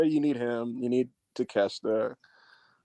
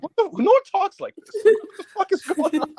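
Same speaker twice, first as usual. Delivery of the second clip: high, laughing disbelief. Real, not acted. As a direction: laugh it out, pitch way up. Laughing at silly movie dialogue.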